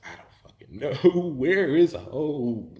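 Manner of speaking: high, loud, drawn-out wail; exasperated panic. Retold or imitated. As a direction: stretch it out, at a loss. Panicked reply about finding home.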